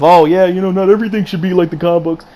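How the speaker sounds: mocking quote. Reviewer mocking people who defend the changes, sneering mimicry. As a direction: parody the excuse makers, sing-song and snide. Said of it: "Don't anybody feed me this crap of".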